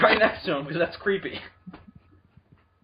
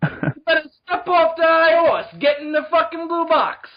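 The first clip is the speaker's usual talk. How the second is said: loud, high Brooklyn tough guy; Brooklyn accent; 10 semitones higher, 5 dB louder than usual. Acted. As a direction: bark it like a Brooklyn wiseguy. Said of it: "the Doctor is from Brooklyn".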